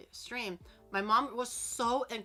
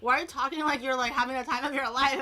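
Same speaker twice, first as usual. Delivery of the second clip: higher, louder concerned questions; worried parents; 5 semitones higher, 6 dB louder than usual. Retold or imitated. Parents alarmed by their daughter's cheerful voice. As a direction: fire off anxious questions. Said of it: "They're gonna be like".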